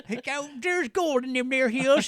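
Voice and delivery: high, slow, hammed-up old miner voice; loud, drawn-out Old West miner impression; old prospector. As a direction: ham up a gold-rush prospector.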